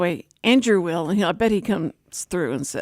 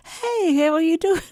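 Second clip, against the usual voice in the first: bright, much higher fake-cheerful hello; forced cheer; 8 semitones higher than usual. Sick woman forcing a cheery phone greeting. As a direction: put on a cheery front over being sick. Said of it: "I try and go like".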